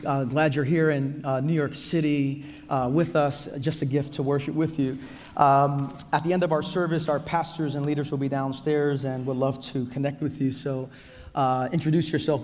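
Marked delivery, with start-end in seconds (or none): none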